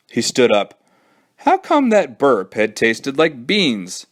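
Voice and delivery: ordinary delivery, nothing marked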